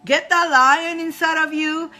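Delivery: loud, hyped, slow call; rallying cheer. Real, not acted. Rallying her viewers to find their lion, loud and hyped. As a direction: pump them up. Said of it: "rallying viewers".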